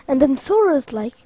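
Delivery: ordinary delivery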